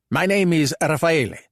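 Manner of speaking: put-on Italian-accented English; pronounced Italian accent. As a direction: speak like a Calabrian thug trying his English. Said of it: "in a pronounced Italian accent".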